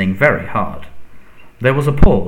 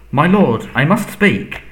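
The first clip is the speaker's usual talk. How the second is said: higher, urgent plea; panicked; 7 semitones higher, 5 dB louder, slower than usual. A narrator's reading. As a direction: burst out, desperate to be heard. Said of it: "panic in his voice now".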